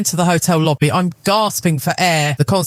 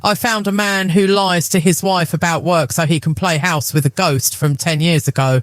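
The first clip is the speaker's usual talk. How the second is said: ordinary delivery, nothing marked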